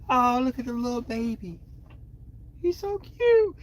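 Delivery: high cooing voice, like fussing over an infant; high baby-talk coo. Pretend. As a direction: coo at him like he's a cute baby. Mocking cooing at Aries baby.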